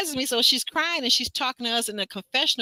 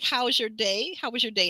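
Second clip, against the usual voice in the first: ordinary talk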